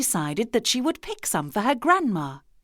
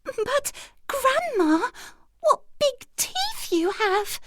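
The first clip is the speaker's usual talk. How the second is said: put-on high, slow, trembling child's cry; voice shaking; slower than usual. A narrator's reading. Girl realizing the danger, high trembling frightened voice. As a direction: quiver with fear like a scared girl. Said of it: "her voice shaking".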